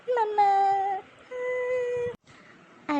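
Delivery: high wailing mamma, drawn out; crying child. Acted. Little girl crying to her mother, high wail. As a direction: call for mum through tears.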